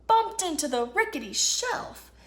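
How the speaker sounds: plain reading voice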